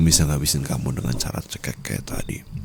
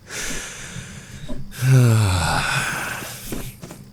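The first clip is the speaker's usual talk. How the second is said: high breathy villain laugh that falls away; a cruel falling chuckle; sinister laugh; 6 semitones higher than usual. Acted. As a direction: laugh at the victim's helplessness. Laugh after the choking.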